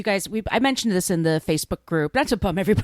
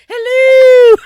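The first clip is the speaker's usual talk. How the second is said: very high, loud falsetto hoot; hammed-up shrieky falsetto impression; Missus Doubtfire; 18 dB louder than usual. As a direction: trill a big, badly faked British nanny greeting. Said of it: "Missus Doubtfire".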